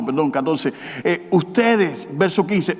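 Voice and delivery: ordinary delivery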